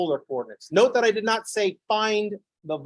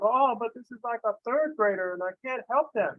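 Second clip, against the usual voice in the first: whiny parent; 6 semitones higher than usual. Retold or imitated. Parent voiced in a story.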